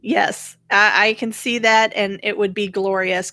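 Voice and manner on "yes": ordinary delivery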